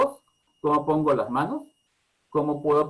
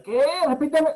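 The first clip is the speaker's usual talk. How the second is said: much higher and loud, clipped; harsh snapped demand; 14 semitones higher, 6 dB louder than usual. Shown as a demonstration. Parent impatiently demanding child repeat, harsh loud snap. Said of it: "en lugar de".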